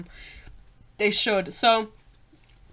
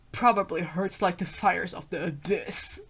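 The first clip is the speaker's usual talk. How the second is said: lightly voiced werewolf, softer; a slightly lowered, quieter character voice; 3 semitones lower, 6 dB quieter than usual. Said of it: "should I do a werewolf voice".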